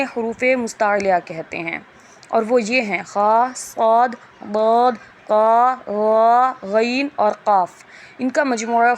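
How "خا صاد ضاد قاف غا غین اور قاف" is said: "خا، صاد، ضاد" thick and drawn out; slow, heavy, rounded "خا، صاد، ضاد"; full-mouthed heavy letters. Shown as a demonstration. Teacher lists the heavy letters, full thick sound. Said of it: "ہمیں پر آواز رکھنی ہے".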